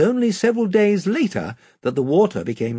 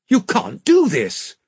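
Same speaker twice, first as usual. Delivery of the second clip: slow, higher, firm objection; stern; 11 semitones higher, 4 dB louder, slower than usual. A narrator's reading. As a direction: put your foot down. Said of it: "said Edmund suddenly and sternly".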